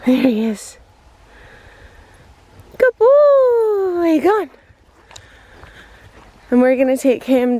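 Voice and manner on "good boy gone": high, loud doggy praise; praising the dog. Real, not acted. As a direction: cheer the dog on from afar.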